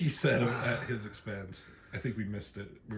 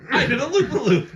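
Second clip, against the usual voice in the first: high, loud, giggly boast; loud laughing mock boast; 12 semitones higher, 8 dB louder, faster than usual. Friend quoting a movie pilot's boast, loud and laughing. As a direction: crow it out, laughing.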